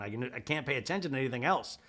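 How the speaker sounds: ordinary talk, nothing marked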